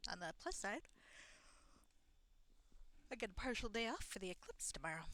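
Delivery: ordinary delivery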